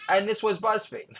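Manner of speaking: "And this was BuzzFeed." said high, stressing "And"; high title read. Shown as a demonstration. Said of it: "No, not".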